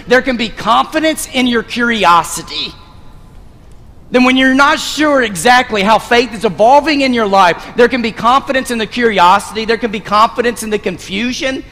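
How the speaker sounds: rising, emphatic sermon refrain; preaching refrain. Acted. Pastor urging the congregation, rising and emphatic. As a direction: hammer the repeated line home.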